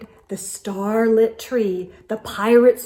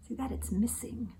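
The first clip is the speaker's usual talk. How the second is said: soft unvoiced aside; whisper; 13 dB quieter, faster than usual. Real, not acted. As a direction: whisper it to the listener. Reader's aside to child.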